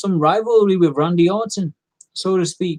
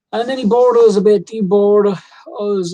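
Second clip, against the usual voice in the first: exasperated complaint; 5 semitones higher, 7 dB louder than usual. Real, not acted. Complaining the match was boring.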